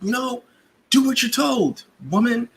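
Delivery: high-pitched mock scolding. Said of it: "scolded her".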